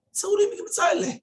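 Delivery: high, loud, incredulous cry; mock outrage. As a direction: exclaim in disbelief at the comforter. Mocking the tactless comforter.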